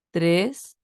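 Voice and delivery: "tres" baja y vuelve a subir; tono tres. Shown as a demonstration. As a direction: di "tres" con el tercer tono. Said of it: "el tono tres que baja". (tercer tono que baja y sube)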